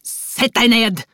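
roared command. A narrator's reading. Father loses patience with screaming daughter, roaring. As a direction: bellow the order at a child. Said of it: "brølte Aksel".

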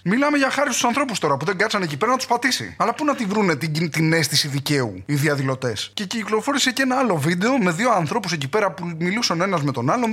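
ordinary talk, nothing marked

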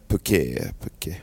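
Phuket said as "poo-KEH", silent final t; low, slow "Phuket" ending in an open eh; tentative local pronunciation. Shown as a demonstration. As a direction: try Phuket carefully as "pookeh", no t. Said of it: "jag tror att man uttalar det så".

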